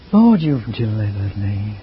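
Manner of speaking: low, slow, drooping delivery; the dull take of the verse; depressive reading. Shown as a demonstration. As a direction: drag it down like a dreary reader. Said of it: "depressive in the way it was read".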